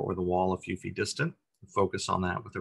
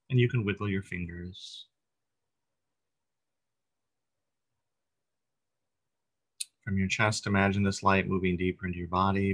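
calm, unhurried meditation guide, long pauses between phrases; guided meditation. Real, not acted. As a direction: gently lead listeners through breath and body, lingering on phrase ends.

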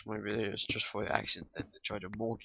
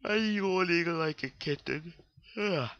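lightly raised cooing voice; 7 semitones higher than usual. Acted. A light coo at a yawning game animal. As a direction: coo at it like a pet.

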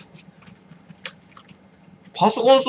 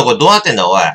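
normal talking voice

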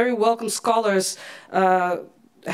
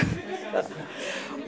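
embarrassed laugh; 7 semitones higher, 12 dB quieter than usual. Real, not acted. Host laughs at own mistake.

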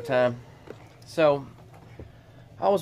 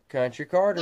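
usual speaking voice

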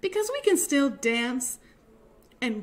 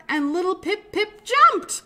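excited narration; 7 semitones higher, 7 dB louder than usual. A narrator's reading. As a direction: build up and burst on the jump, higher and louder. Climax of story, the jump.

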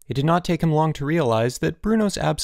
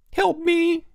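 very high desperate wail; pleading. A narrator's reading. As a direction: beg for help in a squeal. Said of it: "Elmer pleaded".

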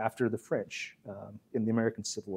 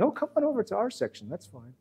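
much higher and fast, breezy invitation; inviting British officers; 8 semitones higher, faster than usual. Retold or imitated. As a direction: keep cheerfully waving them over. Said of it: "the British are still saying".

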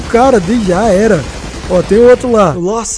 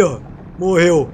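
ordinary talk

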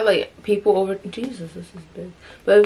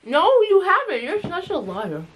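protesting; 9 semitones higher than usual. Real, not acted. Arguing who talked.

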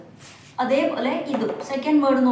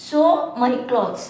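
slow example reading; much slower than usual. Shown as a demonstration.

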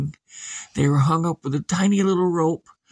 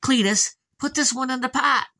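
put-on higher commanding old woman; bossy old lady; 9 semitones higher than usual. Old lady ordering Cletus to cook Samantha, higher. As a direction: order him about. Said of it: "She said".

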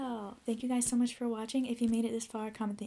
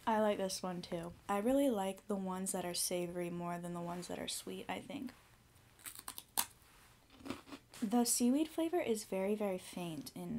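speaking between crunchy bites; talking while chewing; 3 semitones lower than usual. Real, not acted. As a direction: mouth partly full of cracker. Seen 3: Bites cracker at -5, 5.7, 6.3; chews and talks between bites.

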